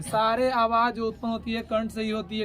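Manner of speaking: ordinary talk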